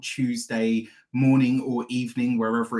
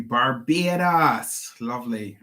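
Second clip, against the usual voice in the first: slow, drawn-out mock Barbadian accent; terrible Barbados accent; slower than usual. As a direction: stretch it out in a badly faked Barbadian accent. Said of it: "that was a terrible accent".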